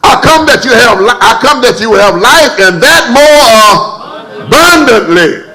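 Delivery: high, loud preaching shout; preacher shouting the promise. Acted. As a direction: shout the scripture to the rafters. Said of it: "shout it out".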